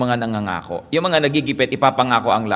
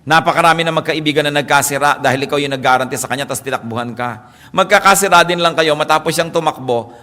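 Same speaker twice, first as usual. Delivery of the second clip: normal talking voice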